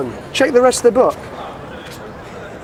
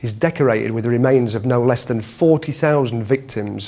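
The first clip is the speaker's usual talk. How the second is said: documentary narration; 6 semitones lower than usual. Acted.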